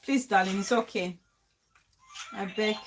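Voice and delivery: low, soft coaxing; quietly reassuring, easing off at the ends; soothing plea. Real, not acted. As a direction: gently beg a friend to calm down. Pleads with upset [name] to calm down.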